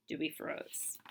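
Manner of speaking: breathy hushed narration; whisper. Acted. Narrator as Dewey freezes, whispered. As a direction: whisper it, holding still. Tense moment.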